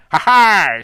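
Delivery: very loud, high pirate cry. Pretend. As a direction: burst out like a stage pirate. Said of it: "I'm a pirate!".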